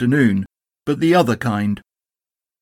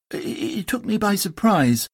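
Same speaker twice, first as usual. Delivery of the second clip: higher, softer, stammering; nervous Piglet; 8 semitones higher, 5 dB quieter than usual. Acted. Piglet timidly names Tigger, soft and nervous. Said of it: "said Piglet nervously".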